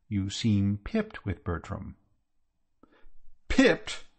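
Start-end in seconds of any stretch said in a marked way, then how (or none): 3.5-4.0 indignant echo. A narrator's reading. Aunt Dahlia throwing her nephew's word back, indignant and high.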